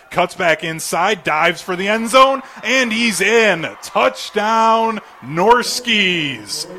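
touchdown call. Real, not acted. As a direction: call the touchdown with a building roar. Scoring play called live.

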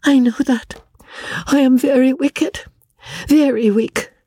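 high, trembling self-reproach; Amelia self-reproaching. A narrator's reading. Amelia answers the Major's wife, high and self-reproaching. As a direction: she blames herself, close to tears.

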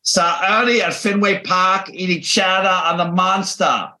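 high, exaggerated Boston accent; hammed-up dropped-r Boston. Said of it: "Here's another one they tried".

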